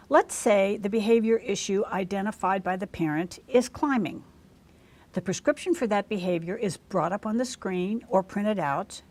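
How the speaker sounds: usual speaking voice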